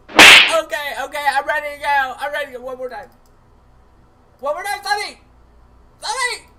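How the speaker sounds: high, loud, fast pep-up; mock psyched-up anchor. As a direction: pump yourself up, squeaky and urgent.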